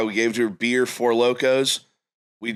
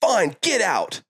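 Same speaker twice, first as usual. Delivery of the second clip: much higher, whiny and alarmed; nervous driver kicking the boys out, much higher and whiny; panicky buddy; 14 semitones higher than usual. Retold or imitated. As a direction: play the scared friend, pitched way up. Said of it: "and he goes".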